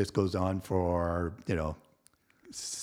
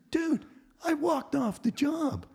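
high, slow retold protest; exasperated protest; 4 dB louder, slower than usual. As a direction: re-enact stating the obvious to the boss. Retold argument with boss, exasperated protest.

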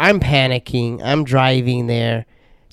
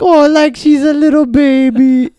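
high, loud mocking voice; teasing baby talk; 13 semitones higher, 7 dB louder than usual. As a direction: coo over a big kid. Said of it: "They're like".

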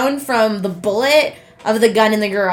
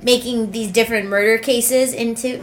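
normal talking voice

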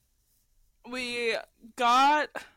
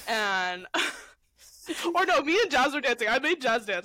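ordinary talk

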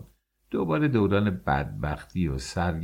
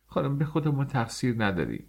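tearful; 7 semitones higher than usual. A narrator's reading. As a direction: narrator lightly voicing a crying girl. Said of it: "با گریه گفت".

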